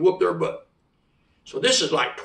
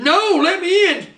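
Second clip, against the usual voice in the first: high, drawn-out desperate protest; acted high-pitched begging; insistent panicked plea; 10 semitones higher, 3 dB louder, slower than usual. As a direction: plead frantically to be let in. Retold panicked plea, refused entry.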